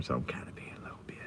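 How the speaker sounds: quick hushed whisper. Real, not acted. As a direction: whisper so people nearby can't hear. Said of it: "So I can't really be too loud".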